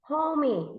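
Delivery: "homie" in two beats, ho-mee; wrong two-syllable reading. Shown as a demonstration. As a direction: sound the silent e in "homie". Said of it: "No, it's not homie".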